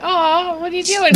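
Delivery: high, sweet mock-coo; cooing at husband. Wife teasing her staring husband, high cooing. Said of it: "I'm just like".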